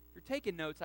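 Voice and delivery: usual speaking voice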